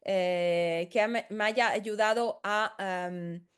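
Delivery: ordinary talk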